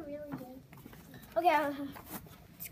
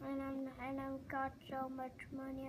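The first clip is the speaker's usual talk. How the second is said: ordinary talk, nothing marked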